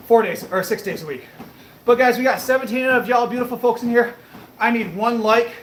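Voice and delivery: ordinary talk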